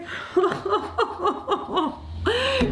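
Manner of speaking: high, broken boo-hoo sobbing; tearful wailing. Acted. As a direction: sob it out in a theatrical wail. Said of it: "She was in tears".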